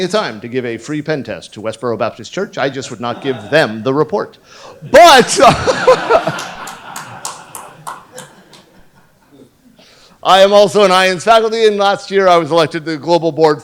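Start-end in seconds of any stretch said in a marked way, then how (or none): 5.4-8.2 high breathy laugh; real laugh. Real, not acted. Laughing at his own Westboro pen-test joke. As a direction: crack up at your own joke.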